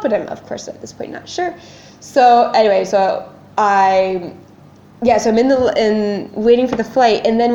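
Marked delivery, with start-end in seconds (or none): 0.3-1.5 whispered. Real, not acted. Unsure aside.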